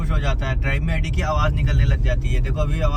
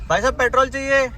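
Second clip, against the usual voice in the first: voicing the dog; 8 semitones higher, 3 dB louder than usual. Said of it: "धनिया बोल दो petrol".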